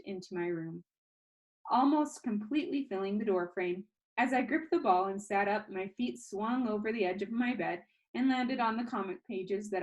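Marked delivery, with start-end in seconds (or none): none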